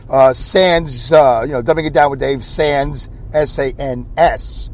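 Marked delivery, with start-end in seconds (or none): none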